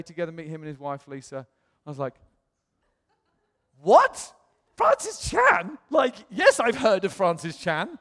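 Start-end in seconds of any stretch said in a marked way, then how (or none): 3.8-7.9 much higher, loud, stretched disbelief; astonished excitement. Retold or imitated. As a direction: gush in stunned delight. Stunned by famous name.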